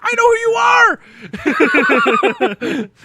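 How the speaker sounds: high, loud starstruck shriek; retold scream. Child face to face with Randy Savage, retold scream. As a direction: reenact a kid screaming in disbelief. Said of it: "I screamed. I was like".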